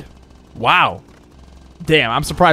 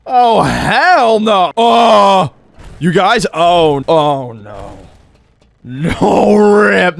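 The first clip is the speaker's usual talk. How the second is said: surprised shout; 3 semitones higher, 5 dB louder, much slower than usual. Real, not acted. Falls through the skate park.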